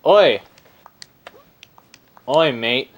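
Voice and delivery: put-on Aussie accent. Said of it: "why I'm quoting".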